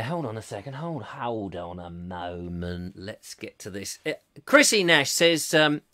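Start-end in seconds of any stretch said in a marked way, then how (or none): 0.0-4.0 murmured to oneself while searching; low stalling murmur. Real, not acted. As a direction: stall quietly, stretching the words low. Stalling while scrolling through messages.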